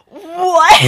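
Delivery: loud, high burst of disbelief; shocked yell. Acted. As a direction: act out a stunned outcry. Lily acted hearing false claim.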